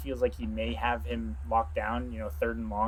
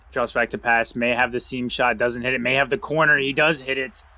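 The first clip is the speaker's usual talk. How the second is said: rising, louder sports call; excited play-by-play; 7 dB louder than usual. Acted. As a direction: build it up as the pass lands.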